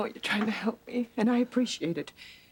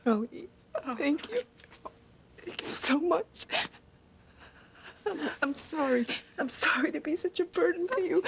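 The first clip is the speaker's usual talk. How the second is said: high, tearful thanks and apology; tearful gratitude; 7 semitones higher than usual. Acted. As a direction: thank your helper through tears, voice rising. Patient tearfully thanks her helper.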